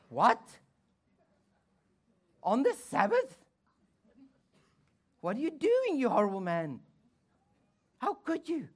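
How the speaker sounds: high, loud scandalised outrage; outraged religious accuser. Retold or imitated. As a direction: scold him as a horrified Pharisee. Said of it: "There will always be somebody".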